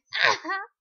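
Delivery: loud, high burst of laughter; involuntary laugh. Real, not acted. Vlogger apologising for laughing, involuntary high laugh. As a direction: let a laugh escape. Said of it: "des fois j'en fais pas trop exprès".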